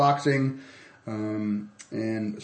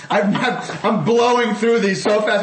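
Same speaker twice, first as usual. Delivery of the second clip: laughing through the words; giggling while rushing the ad; 10 semitones higher, 5 dB louder than usual. Real, not acted.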